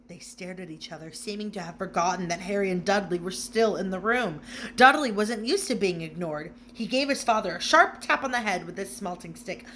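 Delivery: usual speaking voice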